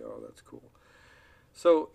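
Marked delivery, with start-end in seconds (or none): 0.0-0.7 soft, flat "Oh, that's cool"; bland reply. Retold or imitated. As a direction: kid blandly brushing off a parent, soft and flat. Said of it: "blandly say".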